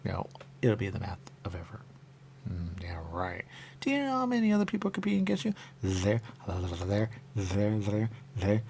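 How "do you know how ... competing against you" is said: high fast rant. Real, not acted.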